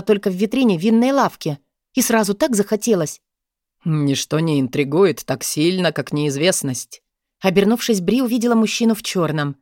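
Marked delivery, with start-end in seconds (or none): 3.8-7.0 baron voice. Acted. Dark baron voiced, lower.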